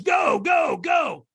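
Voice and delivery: high-pitched imitated trainer's yell; urgent send-off shout. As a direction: launch him down the lane. Retold trainer launching him onto coals.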